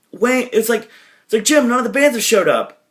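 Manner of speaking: high-pitched urgent protest; mock alarmed complaint. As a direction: imitate someone panicking at bad news. Acted worried protest to [name].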